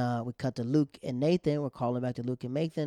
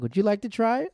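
higher, louder playful taunt; taunting offer; 8 semitones higher, 6 dB louder than usual. Retold or imitated. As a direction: dare her teasingly.

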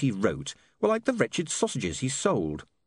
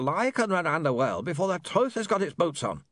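higher-pitched smooth villain voice; pitched-up replayed speech; recorded villain; 8 semitones higher than usual. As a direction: play back the villain's speech through an imp.

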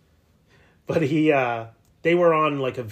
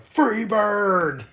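put-on drunk yell; 10 semitones higher, 9 dB louder than usual. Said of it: "I'm like".

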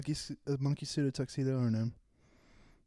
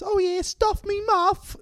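much higher, louder, slow British woman; mock British woman; 7 dB louder, slower than usual. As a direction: ham up a British woman, slowly. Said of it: "She says".

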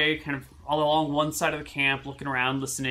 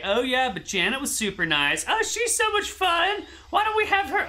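a bad attempt at a Cockney kid, pitched much higher; failed Cockney kid voice; 11 semitones higher than usual. GM voices rich kids gossiping about Janet, failed high Cockney. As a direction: GM squeaks out a rich kid's Cockney and misses it. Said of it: "I can't do a Cockney accent".

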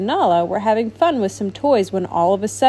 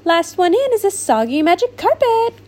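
cried out; 9 semitones higher, 3 dB louder than usual. Acted. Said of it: "cried Shimmer".

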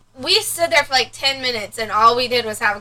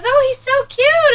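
much higher gushing stranger voice; mock gushing lady; 13 semitones higher, 5 dB louder than usual. Daughter mimicking the store lady gushing over her son, high coo.

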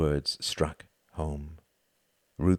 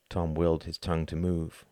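ordinary delivery, nothing marked

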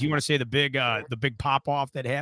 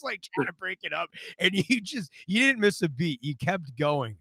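ordinary talk

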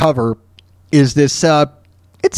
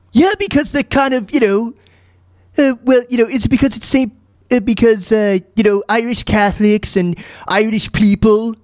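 high-pitched cartoon character voice with an accent; Stewie; 6 semitones higher than usual. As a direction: explain it haltingly in a high, put-on voice. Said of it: "Well, Stewie".

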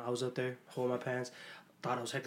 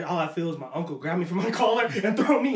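ordinary delivery

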